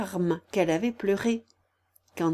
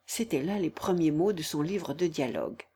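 plain reading voice

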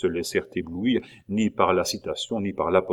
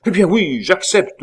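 much higher, louder carried-away yes; enthusiastic yes; 11 semitones higher, 9 dB louder than usual. A narrator's reading. Pitou, carried away, accepts the command with enthusiasm. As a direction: give in with a burst of pride. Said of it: "emporté par son enthousiasme".

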